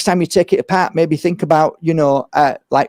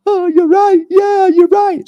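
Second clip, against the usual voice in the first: high, louder parents' voice conceding the point; mock parents agreeing; 4 dB louder than usual. Parents to the healer, suddenly agreeing in a high voice. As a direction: parents caught out, conceding in a higher voice.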